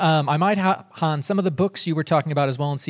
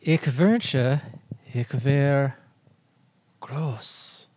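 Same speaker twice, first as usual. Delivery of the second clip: speaking German slowly, in earnest; German line; much slower than usual. Pretend. Host tries the Big line in German, earnest German delivery. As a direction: try the Big quote in German. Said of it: "let me try it".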